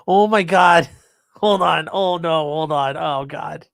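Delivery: loud, laughing disbelief; shocked. Real, not acted. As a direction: react in shock, half laughing. Shocked at what he sees.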